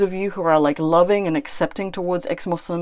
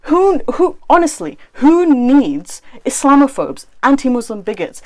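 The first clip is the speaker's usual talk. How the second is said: rising to a pitched, louder yell of disbelief; exasperated yell; 5 semitones higher, 4 dB louder than usual. Real, not acted. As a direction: voice jumps up in outrage. Furious rant at Ali Dawah.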